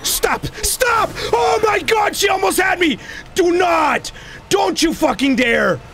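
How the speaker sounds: high, alarmed screaming protest; frightened high-pitched yelling; panicked shouting. Real, not acted. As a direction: yell in panic at an attacker closing in. Nearly caught by game enemy.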